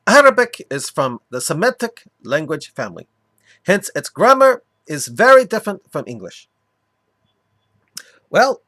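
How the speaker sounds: loud, emphatic mock Arabic accent. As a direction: lecture it in a hammed-up Arabic accent. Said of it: "continue doing my mock Arabic accent for him".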